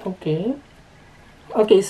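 muffled behind respirator; speaking inside half-mask; through a face mask. Real, not acted. Seen 0: Mask firmly over nose and mouth while adjusting straps during speech.

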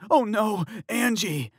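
high, loud panicked scream of a name. Acted. Fiancé finds his collapsed fiancée, screaming. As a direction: scream her name in horror. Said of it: "her fiancé Tyler screamed".

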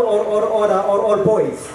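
normal talking voice

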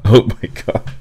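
breathy disbelieving laugh. Real, not acted. Laughing at absurd plot.

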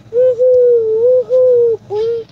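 high, loud bouncing cheers; excited whoops. Real, not acted. Back to bouncing fun.

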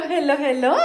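ordinary talk

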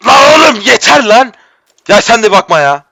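high, loud fed-up yell; furious yell of enough; angry outburst. Real, not acted. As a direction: snap at the game in frustration. Fails the jump again.

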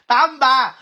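loud, bright call of the number; loud excited reveal; excited announcement. Real, not acted. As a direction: announce the winner with a burst.

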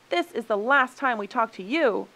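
loud, high parting shot; heated final word; indignant shout. A narrator's reading. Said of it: "shouted the human beings".

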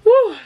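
high loud whoop from spicy food; burning mouth woo. Real, not acted. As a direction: yelp from the heat of the sauce. Reacting to burning hot sauce.

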